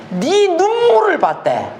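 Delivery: high, slow, every word weighed; emphatic divine word. Acted. Preacher proclaiming God's words to Hezekiah, high and slow. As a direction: lift it high and let it land slowly.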